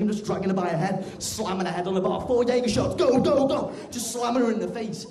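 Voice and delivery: high, shouted, out-of-control frenzy; loud comic rampage, escalating wildly; dead excited. Acted. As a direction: bellow it in a hammed-up manic rush. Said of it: "I just start getting dead excited".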